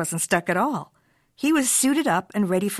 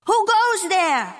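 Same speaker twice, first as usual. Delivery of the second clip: a high, slow, louder call into the dark; hollering; 11 semitones higher, 4 dB louder, slower than usual. Acted. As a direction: holler it out across space. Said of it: "hollered Skipito".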